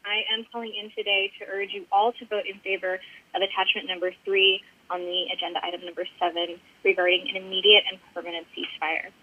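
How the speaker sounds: ordinary delivery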